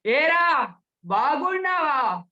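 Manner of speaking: high, long-drawn call; top register. Shown as a demonstration. Speech trainer demonstrates a greeting at high pitch. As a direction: pitch it right up and stretch it out. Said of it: "తారాస్థాయి".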